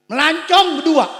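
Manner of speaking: high, loud cry of the phrase; loud preached. Acted. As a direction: shout it out to the crowd.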